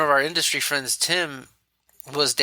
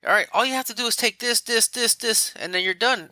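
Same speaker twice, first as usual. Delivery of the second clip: brisk, much higher doctor voice rattling off steps; doctor's quick instructions; 8 semitones higher than usual. Retold or imitated. Doctor tells patient the plan, brisk and high. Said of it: "he's like".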